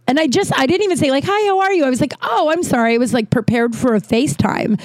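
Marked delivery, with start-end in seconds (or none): 1.3-1.8 high, flustered hi; flustered phone greeting. Retold or imitated. Surprised by a call expecting FaceTime, high flustered greeting. 2.2-3.0 high, startled little sorry; apologise, caught off guard; flustered apology. Retold or imitated. Apologising to the caller she mistook, high flustered sorry.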